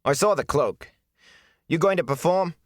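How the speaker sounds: scornful attendant. Acted. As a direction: sneer at the gleeman. Ara's scornful reply.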